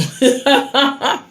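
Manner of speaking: real high-pitched laugh. Real, not acted. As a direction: laugh out at your own remark. Laughs about grown children.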